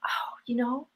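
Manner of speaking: softly demonstrated, easy lead-in; gentle sample reply. Shown as a demonstration. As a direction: ease in very softly, as a suggested reply. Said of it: "Then you say".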